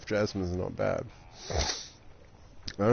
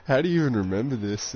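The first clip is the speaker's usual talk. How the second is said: retold disbelief; 6 semitones higher, 3 dB louder than usual. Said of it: "I was like".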